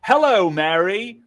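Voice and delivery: a stiff, overly formal hello to mom; stiff formal greeting. Retold or imitated. Stiff mock-formal greeting to your mum. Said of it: "Don't be like".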